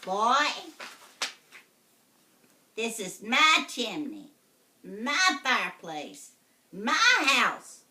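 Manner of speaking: loud witch voice, stress on each "my"; witch claiming. Acted. Witch telling the boy it is her house, loud. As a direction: hammer every "my" like a witch. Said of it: "witch claims house".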